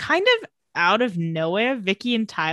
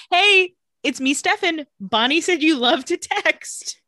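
lightly voiced, higher, slower mock Stefan; 7 semitones higher, 4 dB louder, slower than usual. Stefan texting Elena as Bonnie suggested, a light higher mock voice. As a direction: play him sweetly awkward, a touch hammed-up.

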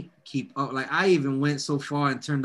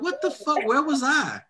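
much higher pitched shock; 12 semitones higher than usual. Retold or imitated. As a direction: yelp it like you missed everything. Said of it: "And I'm like".

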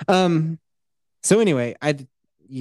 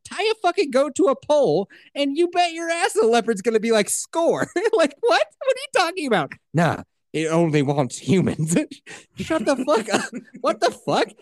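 higher, incredulous ranting; excited disbelief; 10 semitones higher than usual. Real, not acted. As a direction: rant at the movie's nonsense, climbing high. Mocking the film's logic.

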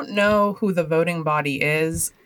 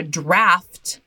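draft leaned on, louder and drawn out; stress on draft; 3 dB louder than usual. Real, not acted. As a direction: give the word draft a big emphatic push. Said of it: "the way you said the word draft".

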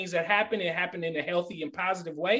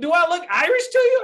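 much higher and louder, firing it back; loud retort; 14 semitones higher, 8 dB louder than usual. Retold or imitated. Speaker retorting to his coworker: loud high indignant retort. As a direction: throw the question back at him. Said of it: "I'm like".

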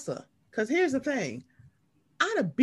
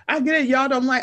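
exasperated raised retort; 7 semitones higher than usual. Retold or imitated. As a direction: snap back, exasperated. Imagined exasperated reply.

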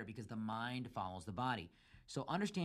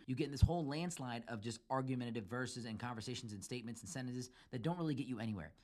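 normal talking voice

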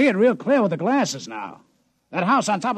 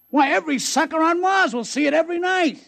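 excited; 8 semitones higher than usual. Acted. Gangster thrilled about his comet.

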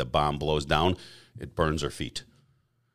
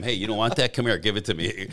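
higher, louder eager pitch to friends; eager call; 6 semitones higher, 5 dB louder than usual. Retold or imitated. Telling friends to hand over cars, excited and louder. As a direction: grab the cars with glee.

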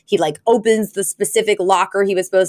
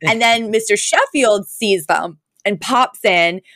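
normal talking voice

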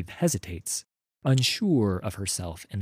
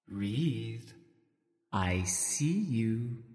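slow, hushed witch intoning a prophecy; 6 dB quieter, much slower than usual. Acted. Ice Witch speaks in dream.

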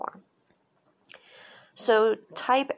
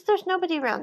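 eager reassurance; 10 semitones higher, faster than usual. Retold or imitated. Man brushing off Type's worry.

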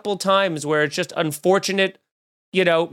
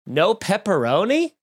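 ordinary delivery

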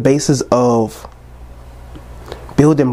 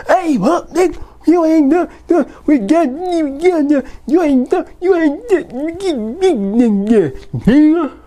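much higher, put-on hype voice, shouting at the camera; mock the people yelling in the screen; 14 semitones higher than usual. Mocking videos yelling at their own people, high hype voice.

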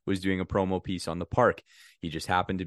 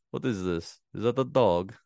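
Borat voice. Retold or imitated. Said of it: "talking in a Borat voice".

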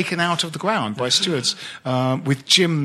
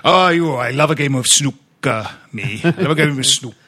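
louder Welsh with stretched syllables; a light Welsh lilt, gaps between syllables; Welsh accent; 4 dB louder than usual. Shown as a demonstration. Showing the co-hosts how to do Welsh, louder, syllables stretched. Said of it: "if you extend the gap between two syllables of a word".

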